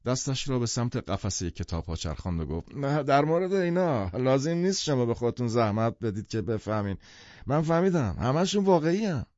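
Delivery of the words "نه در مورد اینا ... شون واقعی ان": tipsy enthusiast, pitch swooping up; excited half-drunk man. Acted. Half-drunk man assures his visitors about the books, excited. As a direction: insist like an eager drunk.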